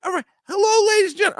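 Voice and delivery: very high squeaky comedian voice; Mario doing stand-up. Retold or imitated. Imitating Mario starting his jokes, very high. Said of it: "he goes".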